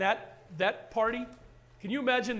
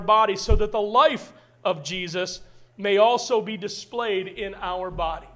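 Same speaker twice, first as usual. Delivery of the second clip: louder, proclaiming reading of Paul; loud preached scripture; 7 dB louder than usual. Acted. Pastor reading Second Corinthians aloud, preaching at full force. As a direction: proclaim the verse to the church.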